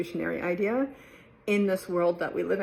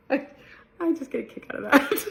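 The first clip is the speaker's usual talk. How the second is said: usual speaking voice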